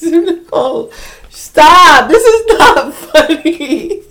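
high protest breaking into laughter; real laughing protest. Real, not acted. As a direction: scold your friend while cracking up. Scolding friend while laughing.

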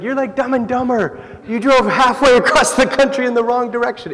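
high, amused ribbing; mock surfer pastor teasing. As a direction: tease them like a surfer dude. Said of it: "And he's just like".

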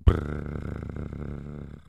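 low rolled lips drum roll; mouth drum roll. Acted. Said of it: "There's a drum roll for you".

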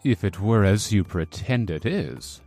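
solemnity laid on for show; affected seriousness. A narrator's reading. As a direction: put on a low, serious tone. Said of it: "addressed the young musketeer with affected seriousness".

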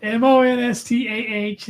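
spelling it out Boston style, no R. Shown as a demonstration. Spelling monster for a friend, Boston style. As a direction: drop the R like a Bostonian. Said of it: "there's no R's".

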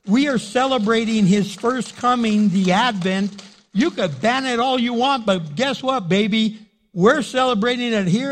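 loud, high, fired-up challenge; thundering from the pulpit, daring the ban; defiant preaching shout. Real, not acted. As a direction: call out the dictator at full force. Preacher defies China's Christmas ban.

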